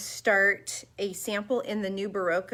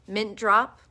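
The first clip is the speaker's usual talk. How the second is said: normal talking voice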